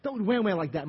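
ordinary delivery

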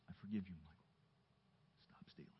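very soft, hushed pardon; quiet kindness. Retold or imitated. God kindly forgiving the young thief, hushed. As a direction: say it gently, nearly whispering. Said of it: "It was his kindness".

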